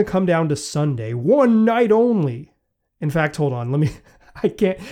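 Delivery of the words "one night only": slow booming announcer voice; monster truck ad. Retold or imitated. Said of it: "I can't say one night only without thinking of this".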